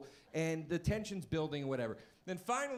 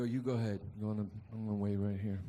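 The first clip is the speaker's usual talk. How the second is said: lower, menacing calm; Goodfellas tough guy; 7 semitones lower, faster than usual. Retold or imitated. Comic quoting Ray Liotta to his parents, lower menacing calm. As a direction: play it like a mobster before a beating. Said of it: "Goodfellas move".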